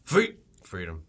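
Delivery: low, slow Scottish cry held back; Braveheart. Acted. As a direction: try a restrained Scottish war cry. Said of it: "I just think of Braveheart".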